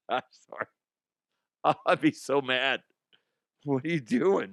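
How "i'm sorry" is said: laughing. Real, not acted. Apologises through laughter.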